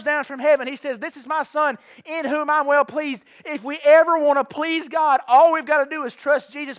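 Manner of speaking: full-throated preacher appeal; preaching at full force. Acted. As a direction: press the gospel home to the room. Preaching climax.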